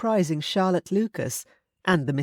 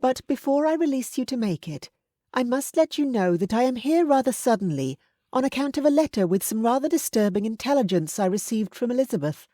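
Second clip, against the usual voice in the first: higher, firm matronly voice; stern aunt; 7 semitones higher than usual. A narrator's reading. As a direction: scold him like a frank aunt.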